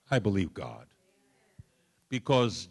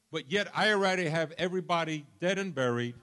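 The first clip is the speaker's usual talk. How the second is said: ordinary talk, nothing marked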